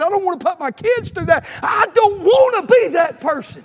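loud, high pleading cry; preacher pouring out his fear of going back, shouting; impassioned preaching. Acted. Preacher confessing to the church, loud pleading.

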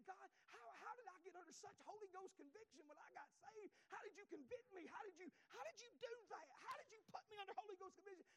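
retelling his prayer at the table, pleading. Retold or imitated. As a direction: plead up to God. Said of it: "And I said".

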